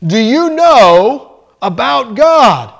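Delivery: lightly voiced pastor, slow and insistent; mild booming preacher repeating himself; pastor asking again. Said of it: "So the pastor asked him again".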